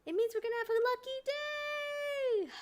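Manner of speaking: very high, gushing squeal; excited squeal. Retold or imitated. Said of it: "I was like".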